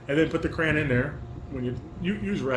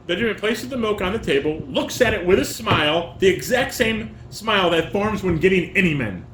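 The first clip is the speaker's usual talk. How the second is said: reading stage directions; 4 semitones higher, 4 dB louder than usual. Acted. Reads stage directions slowly.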